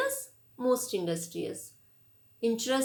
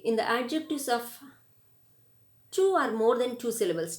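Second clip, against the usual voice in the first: ordinary talk, nothing marked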